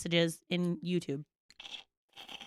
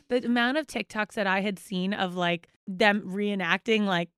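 ordinary talk, nothing marked

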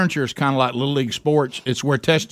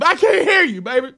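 much higher, louder, gasping retort; breathless protest; 13 semitones higher, 3 dB louder than usual. Retold or imitated. Husband out of breath telling wife he can't hear, loud. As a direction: blurt it over your own panting. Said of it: "I said".

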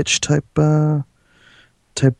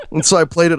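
ordinary talk

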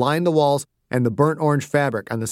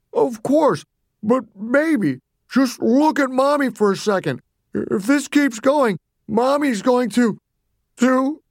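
high, strained, tearful mother; distressed Mommy, pleading; 10 semitones higher than usual. Acted. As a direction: beg the child and falter at the end. Voices crying Mommy.

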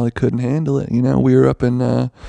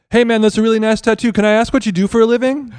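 high, quick street-interview patter; mock TikTok interviewer; 10 semitones higher, faster than usual. Mock TikToker approaching a stranger, high and quick. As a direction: pitch it up and rattle off the question. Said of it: "like I was doing a TikTok".

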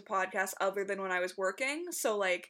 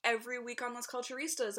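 ordinary talk, nothing marked

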